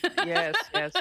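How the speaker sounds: laughing through a mock-romantic answer; laughing joke. Real, not acted. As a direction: playfully play the romantic. Joking about romantic hobbies.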